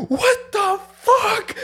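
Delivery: very high, drawn-out wail; freaking out. Retold or imitated. Passenger hurt by the landing, freaking out. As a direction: stretch it into a squealing, hysterical protest. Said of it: "I'm just freaking out".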